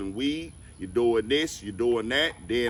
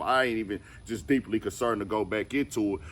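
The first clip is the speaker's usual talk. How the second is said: ordinary delivery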